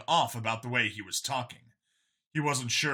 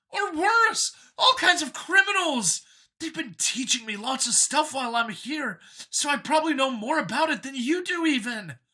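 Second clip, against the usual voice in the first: higher, louder, keen voice; a cheerful zealot pitched up; android copy, eager; 11 semitones higher, 4 dB louder than usual. A narrator's reading. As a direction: sell it like a proud believer. Copy rants about species.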